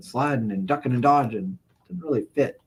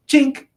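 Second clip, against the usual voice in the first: hook sound effect; 13 semitones higher, 6 dB louder than usual. Retold or imitated. Imitating the hook's sound.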